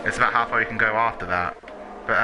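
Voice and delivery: usual speaking voice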